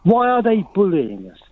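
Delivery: usual speaking voice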